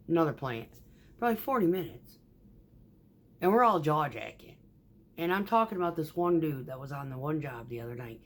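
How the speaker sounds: normal talking voice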